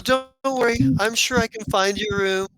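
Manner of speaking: high, teasing sister call; mocking sister calling after brother; taunting sister. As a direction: sing it after him sweetly. Sestra taunting departing brother.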